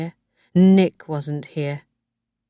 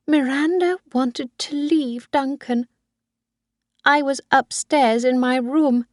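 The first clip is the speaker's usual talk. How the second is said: put-on high old woman's voice, resentful as she recounts; a much higher old mother telling her dark story; Missus Harvey, bitter confession; 8 semitones higher than usual.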